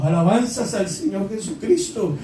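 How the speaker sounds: ordinary talk, nothing marked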